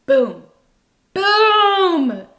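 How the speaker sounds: loud high double boom, the second one soaring; triumphant boom. Real, not acted. Tarot reader celebrating her own point, loud boom. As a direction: punctuate the point with a big booming shout.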